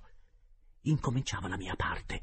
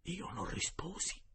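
plain reading voice

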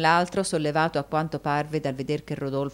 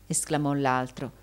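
ordinary delivery

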